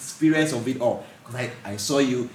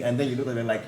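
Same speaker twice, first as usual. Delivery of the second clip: ordinary talk, nothing marked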